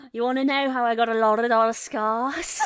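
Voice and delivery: loud Scouse villain, laughing; Scouse Joker. Acted. Streamer acting out the imagined meme, loud laughing Scouse Joker. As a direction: belt it out in Scouse through laughter. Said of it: "what if the Joker was Scouse?".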